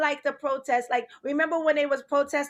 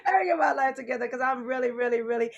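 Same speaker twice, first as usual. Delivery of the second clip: mock host voice; 3 semitones lower than usual. Mock show host intro.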